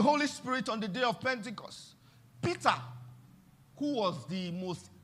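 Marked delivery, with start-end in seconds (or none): none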